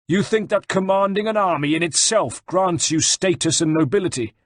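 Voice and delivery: high-pitched, impassioned retort; indignant rebuke. A narrator's reading. Captive general rebukes his wife's kidnapper, indignant. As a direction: shame the enemy for his cowardice. Bartolomeo angrily lectures de Valois.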